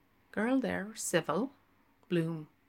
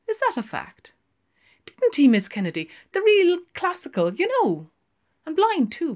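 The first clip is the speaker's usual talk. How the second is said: put-on quick, much higher young barmaid; 12 semitones higher, 9 dB louder than usual. Barmaid answering Lenehan, high and quick. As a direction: pert barmaid, rattling it off.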